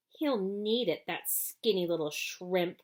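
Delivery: ordinary delivery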